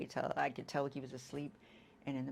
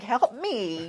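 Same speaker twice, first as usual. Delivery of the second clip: plea for help; 7 semitones higher, 11 dB louder than usual. Retold or imitated. Pleading with her other son.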